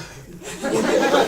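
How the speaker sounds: airy high burst of laughter; breathy laugh. Real, not acted. Remembering the stifled sneeze in the car, breathy laughing.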